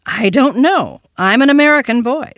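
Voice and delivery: put-on high, loud little boy; child. A narrator's reading. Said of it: "said the child".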